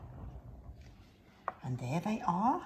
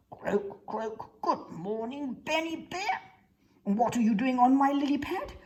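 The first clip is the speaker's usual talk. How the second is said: croaking frog with a higher voice; croak, then greet in a raised voice; Mister Frog, inquisitive; 8 semitones higher, 4 dB louder than usual. Acted.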